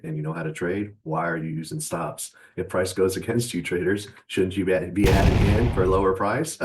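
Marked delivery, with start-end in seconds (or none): 4.3-6.6 loud mocking question. Real, not acted.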